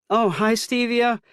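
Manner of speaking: high, slow motherly call. A narrator's reading. Mother calling hello to her son as she comes in. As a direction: call out brightly to your kid. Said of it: "she calls out".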